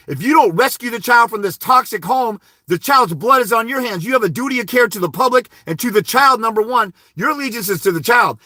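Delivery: demonstrating an impassioned argument to the bench; forceful courtroom plea. Shown as a demonstration. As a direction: press every point on the judge with urgency. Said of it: "You gotta go in and say".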